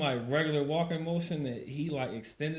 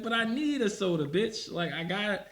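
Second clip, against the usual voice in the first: whiny demand; 10 semitones higher, 4 dB louder than usual. Retold or imitated. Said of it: "It'd be like".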